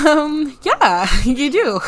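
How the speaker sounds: high, loud, drawn-out "well, yeah"; awkward admission. Pretend. Woman admits to him he knows the culprit, playfully awkward. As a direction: stretch it out loudly with a laugh breaking in. Voicing awkward reveal about friend.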